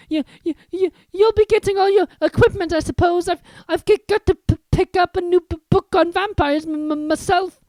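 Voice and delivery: high, nervous stutter; stammering Quirrell. Acted. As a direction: stutter anxiously.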